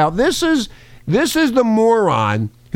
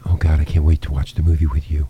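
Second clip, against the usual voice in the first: low, lightly voiced woman's quote; mock eager woman; 11 semitones lower than usual. Host teasing what she says in his ear, low mock voice. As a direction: put her words in a low, teasing voice. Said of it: "She's going".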